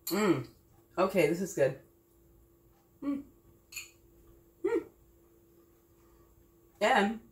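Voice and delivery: talking while chewing; words around a bite of food; mouth full. Real, not acted. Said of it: "excuse me while I talk with my mouth full".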